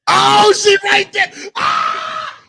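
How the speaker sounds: loud, high yelp of alarm; shrieked, caught-out reaction; startled outburst. Real, not acted. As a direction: shout it in sudden shock, ending in a scream. Startled outburst at someone appearing.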